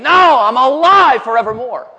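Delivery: loud, high, drawn-out proclamation; triumphant rebuttal. Acted. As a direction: overrule the despair with a slow cry. Jesus answering human despair.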